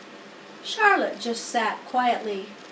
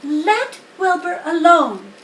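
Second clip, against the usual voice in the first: sharp, louder rebuke; sharply; 7 semitones higher, 8 dB louder than usual. A narrator's reading. Spider defends the pig against the lamb, sharp. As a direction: snap the lamb into silence, high. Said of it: "she spoke sharply to the lamb".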